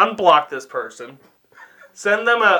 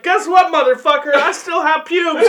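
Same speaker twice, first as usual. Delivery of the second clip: high, hammed-up taunting caller; spring it on him triumphantly; gleeful prank taunt; 10 semitones higher, 4 dB louder than usual. Acting out the planned prank call.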